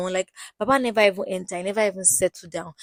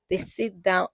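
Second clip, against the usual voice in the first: ordinary talk, nothing marked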